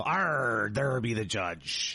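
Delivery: slow hammed-up pirate growl. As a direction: arr like a pirate in court. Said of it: "You're like".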